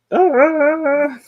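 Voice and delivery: high sharp cry of surprise; startled yelp. Real, not acted. Streamer yelping high when the toy's leg pops off. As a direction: yelp as the toy breaks.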